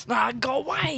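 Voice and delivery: acted panic, pitched well up; freaked-out cry. Pete to the foxy thing in the maze, freaked out. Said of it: "Pete gets totally freaked out".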